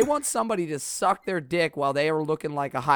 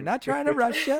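high, light mimicry of a server; imitated service voice; 9 semitones higher than usual. Co-host playing back the server's line, imitated service voice. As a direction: echo her lighter customer service voice. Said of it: "a little of the voice".

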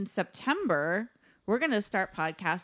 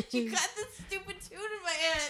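high, laughing complaint; 8 semitones higher than usual. Real, not acted. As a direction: whine it through laughs.

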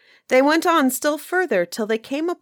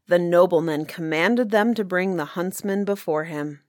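usual speaking voice

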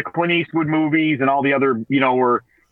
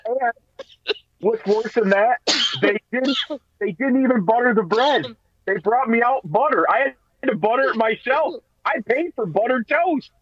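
raised, higher outrage; indignant; 7 semitones higher than usual. Real, not acted. Husband complaining about unbuttered bread, raised and indignant. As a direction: complain like you were robbed.

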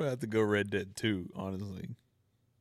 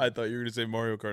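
usual speaking voice

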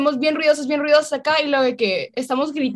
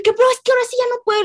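upset; 11 semitones higher, much faster than usual. Retold or imitated. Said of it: "empecé a alterarme acá".

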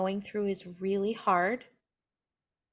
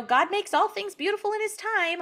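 glib platitude; 10 semitones higher than usual. Retold or imitated. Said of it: "you know".

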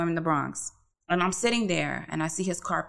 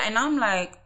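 normal talking voice

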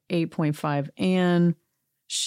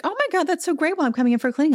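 mock polite enthusiasm; 10 semitones higher, much faster than usual. Patient brushing off her flirting dentist, mock polite enthusiasm. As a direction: imitate an over-eager polite deflection.

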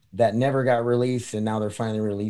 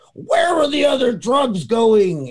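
much higher, loud, slow growled shout; mock Batman voice; 12 semitones higher, 6 dB louder, slower than usual. Retelling a sketch, Batman shouts at an upside-down crook in a Batman voice. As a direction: bark the threat like a cartoon Batman. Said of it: "What voice is that?".

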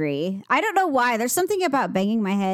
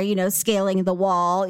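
ordinary talk

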